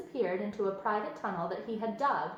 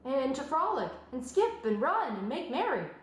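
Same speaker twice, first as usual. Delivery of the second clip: higher, bouncy explaining of fun; eager piglet; 5 semitones higher than usual. A narrator's reading. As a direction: sell the joy of play.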